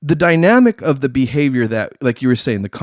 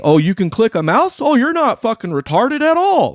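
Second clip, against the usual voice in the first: mocking evaluator; 9 semitones higher than usual. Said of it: "look for anything".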